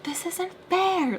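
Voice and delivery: high, drawn-out complaint; retold high-pitched protest; mock protest. Voicing own indignation in a retelling.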